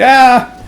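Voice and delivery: sharp high yelp; startled yelp. Real, not acted. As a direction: yelp of surprise as it blows. Hit by grenade blast.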